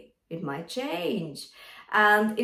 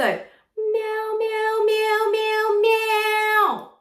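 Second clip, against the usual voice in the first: much higher, growing louder meows; mixed voice meow; 13 semitones higher, 6 dB louder, much slower than usual. Shown as a demonstration. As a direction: push the meows forward with more power. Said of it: "hear that change in power".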